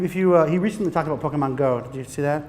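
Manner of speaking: ordinary talk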